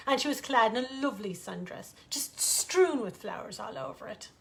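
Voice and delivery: plain reading voice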